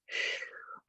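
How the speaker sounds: long soft exhale through the mouth; audible breath out. Shown as a demonstration. Instructor demonstrating breathing to her class, soft exhale. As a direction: let the air go slowly. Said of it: "In through your nose".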